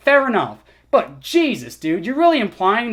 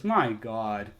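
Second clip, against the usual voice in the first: usual speaking voice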